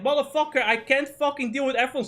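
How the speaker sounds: exasperated outburst. Retold or imitated. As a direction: vent fury at the excuses. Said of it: "it's like".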